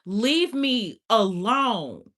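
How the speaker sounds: slow, drawn-out leave me alone; mock exasperated, slow; drawn-out brush-off. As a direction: stretch it out, done with them. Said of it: "you like".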